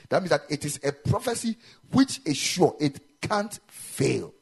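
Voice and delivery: loud, and very slow on the last words; preacher driving the point home; emphatic preaching. Real, not acted. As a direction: lean on it, slowing to a stop. Preaching at full force.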